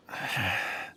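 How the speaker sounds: soft tired breath out; faint exhausted sigh; weary sigh. Acted. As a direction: let the dizziness out in a sigh. Kunjun lightheaded, recovering.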